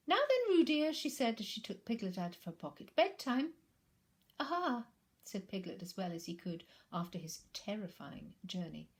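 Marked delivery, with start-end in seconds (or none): 0.1-3.5 high, loud cheerful mothering; Kanga, playful mother. Acted. Kanga jokes along. 4.4-4.9 weak aha. Acted. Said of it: "it wasn't very good".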